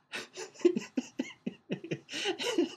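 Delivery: squealing laugh that shoots way up; high burst of laughter. Real, not acted. Dad laughing as he retells her surprising answer, high-pitched. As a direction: let the laugh break out high.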